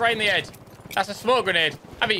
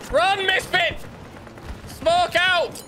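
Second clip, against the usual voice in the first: higher, urgent call to squad; grenade warning yell; 9 semitones higher, slower than usual. Real, not acted. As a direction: yell orders across the field. Calling to squad under fire.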